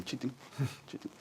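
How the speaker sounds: soft, clipped chiting of reggae guitar strokes; guitar skank imitation. Poet shows how the Indian guitarist held the rhythm, soft guitar-chop imitation. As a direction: mouth the offbeat guitar chop quietly.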